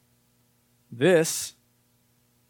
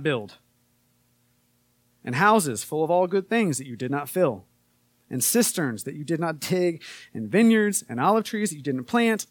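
scripture reading; 3 semitones higher, 4 dB louder than usual. Acted. Pastor reading Deuteronomy aloud to the church, in a proclaiming voice. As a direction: proclaim the verses from the pulpit.

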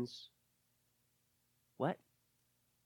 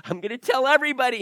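excited; 6 semitones higher, 7 dB louder, much faster than usual. Retold or imitated. Woman voiced after meeting Jesus.

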